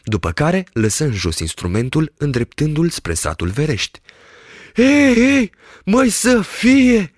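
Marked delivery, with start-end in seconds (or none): 4.8-7.1 put-on high, drawn-out marvelling old shepherd; shepherd gasps in wonder; amazed exclamation. A narrator's reading. Shepherd marvels at the spyglass, high and amazed. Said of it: "se minună el".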